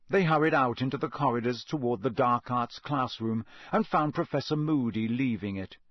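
ordinary delivery

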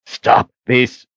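strained, forced through clenched teeth; through gritted teeth. Acted. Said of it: "he managed through gritted teeth".

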